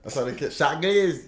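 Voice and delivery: Shaggy said as Shaq Lizzy in DC accent. Shown as a demonstration. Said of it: "That's my D C accent".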